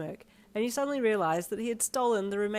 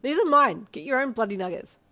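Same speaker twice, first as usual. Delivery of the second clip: high, loud playful protest; loud and possessive; 6 semitones higher, 10 dB louder than usual. A narrator's reading. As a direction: shout it, chewing. Defending the nuggets, mouth full.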